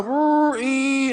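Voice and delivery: engine strain. Retold or imitated. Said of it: "so I go".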